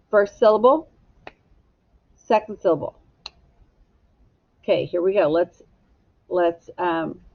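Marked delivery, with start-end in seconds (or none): none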